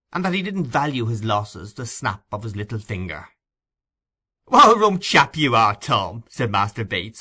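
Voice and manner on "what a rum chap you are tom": much louder, higher jeer; mock him with delight; teasing. A narrator's reading. Master Bates mocking.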